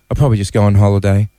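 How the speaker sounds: imitated sad voice, low and quick; saddest voice. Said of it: "in the saddest voice I've ever heard".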